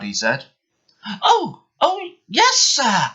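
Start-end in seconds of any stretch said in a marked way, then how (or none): 1.2-3.1 very high, eager little voice; peeping. Acted. Little green engine eagerly answers the Fat Controller, high and peeping. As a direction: squeak it out, eager to please. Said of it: "peeped the little green engine".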